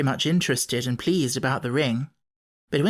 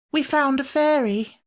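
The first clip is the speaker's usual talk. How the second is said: obedient; 11 semitones higher than usual. A narrator's reading. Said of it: "said Jane obediently".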